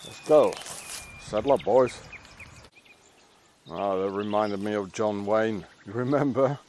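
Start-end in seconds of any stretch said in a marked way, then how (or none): none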